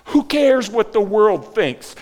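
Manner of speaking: loud, slow, defiant cry. Acted. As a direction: fling it out in defiance of the world. Mary's defiant inner voice.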